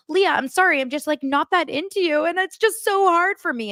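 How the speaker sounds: pouty self-pity; lightly mocking a whiny breakup excuse; mock whiny Rob. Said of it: "He was like".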